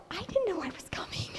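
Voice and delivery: high, fast whispery aside; hushed disbelief; retold inner amazement. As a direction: think it to yourself, astonished. Retold thought to herself.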